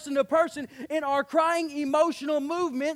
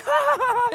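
a sudden laugh, much higher and louder; mock burst of laughter; 12 semitones higher, 8 dB louder than usual. Imitating laughing it off.

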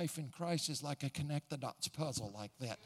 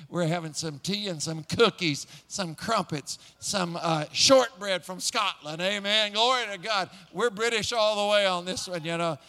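louder, building excitement over the tea and shortbread; excited; 4 semitones higher, 8 dB louder than usual. Real, not acted. Preacher telling the congregation about Concorde, excited and louder. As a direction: pour out the thrill of it, louder and louder.

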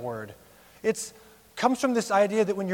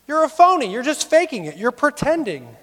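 mock insult; 7 semitones higher, 6 dB louder than usual. Retold insults, one after another.

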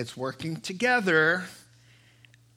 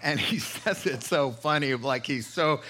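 normal talking voice

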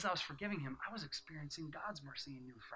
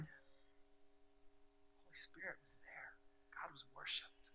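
slow, breathy, reverent whisper; awed whisper; 10 dB quieter, much slower than usual. Real, not acted. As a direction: let it out slow in awe.

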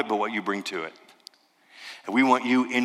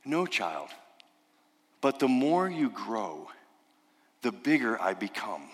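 softer, slow and gentle lion; tender Aslan; 6 dB quieter than usual. Retold or imitated. Aslan gently reassuring Lucy, soft and tender. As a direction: soothe the child softly. Aslan voiced in Narnia retelling.

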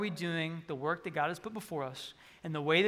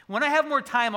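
mock excuse; 6 semitones higher, 5 dB louder than usual. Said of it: "mimicking a complacent excuse".

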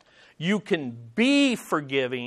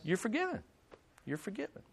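much softer, simple "You're forgiven"; quiet pardon; 4 semitones higher, 13 dB quieter, faster than usual. Pretend. Stephen answering Saul's plea for forgiveness, soft and quick. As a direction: say it gently, right away. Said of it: "what would Stephen immediately said?".

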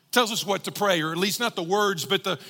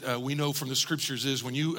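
normal talking voice

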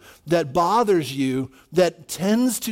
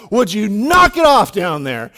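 louder retold yell at a child; 7 dB louder than usual. Father yelling downstairs at his son, exasperated shout. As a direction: re-enact an exasperated parent's yell. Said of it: "I just yelled at him".

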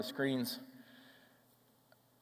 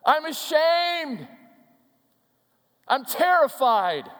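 acted shame and terror; 7 semitones higher, 5 dB louder, slower than usual. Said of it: "answer could have been something like this".

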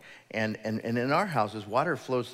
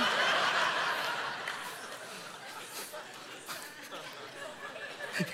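laugh; 12 semitones higher, 5 dB quieter than usual. Real, not acted. Laughs at own joke.

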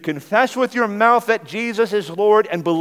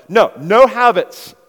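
forceful rebuttal; 5 semitones higher, 10 dB louder than usual. Real, not acted. Preacher rebuts imagined objector.

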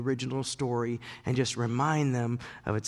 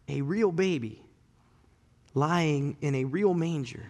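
ordinary talk

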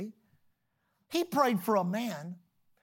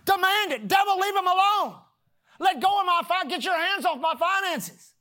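loud, high preaching cry; rising preacher's shout commanding devils; fiery preaching; 7 semitones higher, 5 dB louder than usual. Acted. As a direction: preach it out at full force. Preaching at full force.

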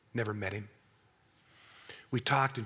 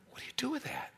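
soft breathless whisper; whispered grief; 8 semitones higher, 10 dB quieter, faster than usual. Real, not acted. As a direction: barely voice the question. Grief over dying son.

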